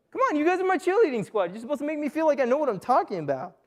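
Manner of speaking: high, fast mock complaint; mock pleading. Preacher begs the cheerleading squad for backup, high mock-pleading. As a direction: plead quickly with friends who left you hanging.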